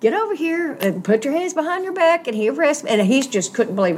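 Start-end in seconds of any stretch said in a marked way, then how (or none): 0.0-2.2 a much higher, mock-stern policeman ordering her; imitating the cop. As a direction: play the arresting cop, higher and official. Retelling the arresting cop.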